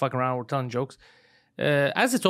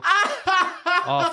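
surprised gasp; 13 semitones higher than usual. Retold or imitated. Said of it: "I go".

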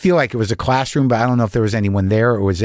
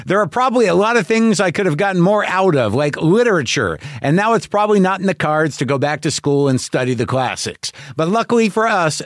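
higher-pitched, polished podcast sponsor read; 6 semitones higher than usual. Real, not acted. Reads a podcast advertisement.

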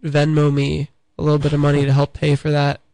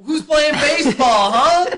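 high put-on voice; 11 semitones higher, 4 dB louder, slower than usual. Ben's put-on character voice.

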